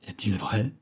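usual speaking voice

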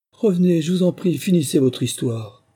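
high, loud pleading call. A narrator's reading. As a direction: beg someone leaving to come back. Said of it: "lui cria Alice".

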